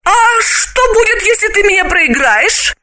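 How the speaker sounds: high-pitched worried woman; Galina's voice. Acted.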